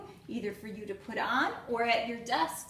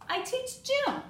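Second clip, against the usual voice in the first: much higher, bright; gym teacher voice; 13 semitones higher than usual. Acted. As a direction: chirp it up high.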